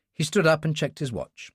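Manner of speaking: plain reading voice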